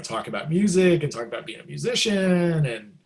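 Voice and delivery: ordinary talk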